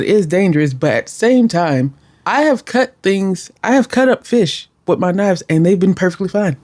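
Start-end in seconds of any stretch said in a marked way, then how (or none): none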